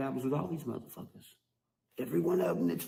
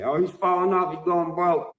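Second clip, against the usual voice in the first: louder, higher taunting gossip; mock gossip; 4 semitones higher, 5 dB louder than usual. Rapper mocking rivals' rumors, loud taunting sneer. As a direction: sneer their rumor back at them. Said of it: "What else do they say?".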